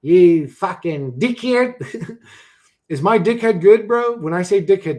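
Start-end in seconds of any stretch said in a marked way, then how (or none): 0.0-2.2 drawn-out Aussie insult, high and loud; Australian accent. Acted. Streamer teasing an Australian viewer, mock Australian accent. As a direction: stretch it out in a hammed-up Australian accent. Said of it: "does it sound like a real Australian?".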